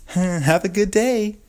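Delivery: very high, slow, syrupy mock of her cheery goodbye; mocking sing-song lady. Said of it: "I was fucking fuming".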